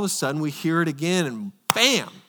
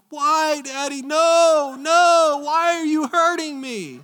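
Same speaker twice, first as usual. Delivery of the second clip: put-on high, pleading child's voice, much higher and louder; frightened little girl; 12 semitones higher, 4 dB louder, slower than usual. Daughter begging her father on the table, high and frightened. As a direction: plead like a hurt three-year-old. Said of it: "and she's like".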